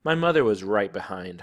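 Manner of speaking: usual speaking voice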